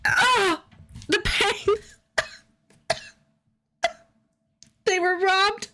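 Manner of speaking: high, wailing fake grief; high mock wail of pain; mock anguish. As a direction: ham up the heartbreak. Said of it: "I'm not tearing up".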